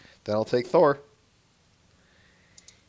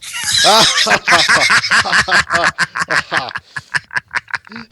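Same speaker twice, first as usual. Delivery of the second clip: loud hearty god laugh; a big roaring belly laugh; booming laugh; 7 semitones higher, 13 dB louder than usual. Acted. Thor laughing at the feast, loud and booming.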